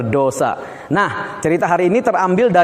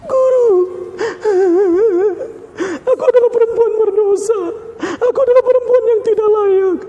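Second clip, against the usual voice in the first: high, tearful crying plea; a sinful woman weeping at his feet; sobbing woman; 14 semitones higher than usual. Acted. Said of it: "Perempuan itu datang dengan sangat sedih". (perempuan memohon sambil menangis)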